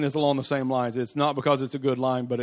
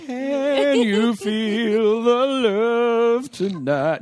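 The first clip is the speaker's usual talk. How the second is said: high, very slow, swooning; mock romantic; 9 semitones higher, 6 dB louder, much slower than usual. Mocking the romance he expected, sung swoony. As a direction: ham up a sappy love ballad line. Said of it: "I'm like".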